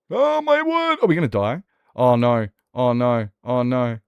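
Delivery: mock panic. Streamer panicking at the game, high loud mock panic. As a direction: shriek like doom is coming.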